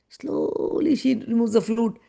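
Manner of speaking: stretched first vowel, storyteller style; slow, elongated narration; drawn-out slowly. A narrator's reading. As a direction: draw the word out like slow motion. Narrating a stealthy theft.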